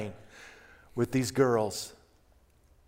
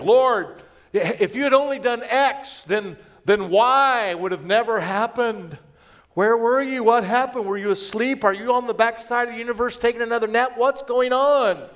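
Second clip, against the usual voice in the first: higher, louder indignant questioning; accusing complaint; 7 semitones higher, 4 dB louder than usual. Acted. Believer blaming God for not showing up, accusing. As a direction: demand answers, voice pitched up. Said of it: "sometimes we do that with God".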